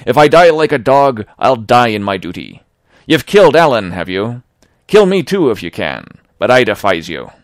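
honest seaman defying the pirate, loud and angry; heroic defiance. Acted. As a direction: hurl it at the murderer. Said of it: "Tom flashed out like a hero".

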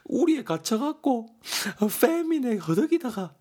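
hammed-up grief rising to a high wail; mock lament. Vegetarian Daegeon grieving for the cows, high mock wail. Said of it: "대건이가 말합니다".

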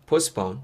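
"postpone" said as "pos-pone", T left out; reduced middle T. Shown as a demonstration. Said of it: "정도 발음하시면 될 것 같아요". ("postpone"의 T를 빼고 "포스폰")

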